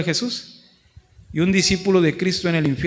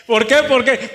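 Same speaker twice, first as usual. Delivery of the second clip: put-on higher, louder, rapid-fire child's why; insistent little child; 6 semitones higher, 6 dB louder than usual. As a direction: keep pestering like a three-year-old. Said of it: "cuándo empiezan a preguntar el por qué los niños". (niño insistente, más agudo y fuerte)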